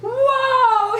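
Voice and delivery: mock shocked yell; startled outcry. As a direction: burst in with a cry of alarm. Host bursts in reacting.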